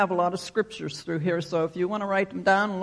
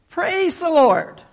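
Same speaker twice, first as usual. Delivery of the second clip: high, slow, loud shout of praise; joyful shout; 10 semitones higher, 6 dB louder, slower than usual. Acted. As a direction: call it out from the porch for all to hear. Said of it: "and she would shout".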